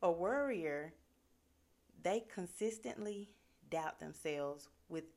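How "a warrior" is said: "warrior" louder, with a clear war; a corrected, firm "warrior"; careful warrior. Shown as a demonstration. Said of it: "I'm getting tongue twisted".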